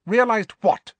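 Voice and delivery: high, loud, sharp question. A narrator's reading. Snape presses Harry for what he realized, sharply. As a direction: demand the answer sharply. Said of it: "asked Snape sharply".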